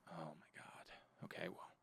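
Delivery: very soft, slow private groan of words; dismayed aside. Retold or imitated. Tester stuck without directory access, barely audible dismayed aside. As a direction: say it under your breath, to yourself.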